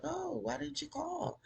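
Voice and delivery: high, fake-sweet greeting; mock innocent. [name] faking surprise at their visit, high and sweet. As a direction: put on a falsely sweet high voice.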